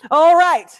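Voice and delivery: high, loud "moving on" cry; loud rallying call. Real, not acted. Host launches the prize drawings, loud rallying call. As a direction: belt it out to rally the room.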